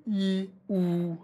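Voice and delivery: held "E", then held "oo", tongue moving front to back; vowel contrast demo. Shown as a demonstration. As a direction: model "E" as ee and "oo" as a long back vowel. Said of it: "Don't be afraid to say it".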